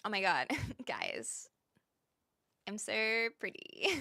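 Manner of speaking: put-on breathless whisper of a vain girl gushing; mock-whisper, gushing over her own looks; mocking a vain streamer gushing about her looks. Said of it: "I'm not sitting here being like".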